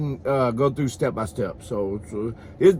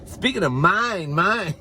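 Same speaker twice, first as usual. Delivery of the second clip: drawn-out lilting mine; 6 semitones higher, slower than usual. Acted. Playing on the kid's "mine.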